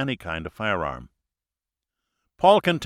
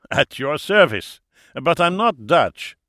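put-on higher, louder German-accented host; jovial German accent; 5 semitones higher, 5 dB louder than usual. A narrator's reading. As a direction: correct them merrily in a German accent. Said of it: "he laughed".

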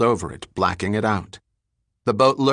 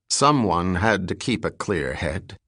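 plain reading voice

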